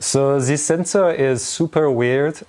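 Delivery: slow, exaggerated French accent. Shown as a demonstration. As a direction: put on a thick French accent. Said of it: "That's my best French accent".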